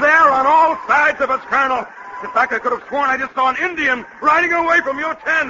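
high-pitched, agitated alarm; panicked report. Acted. General telling the Colonel they are surrounded, high and panicky. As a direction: report the enemy in a loud, high fluster.

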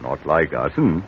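lightly voiced West Country yokel; a friendly menace behind the country charm; country gardener chatting to the old lady; rustic gardener.

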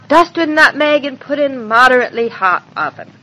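ordinary delivery

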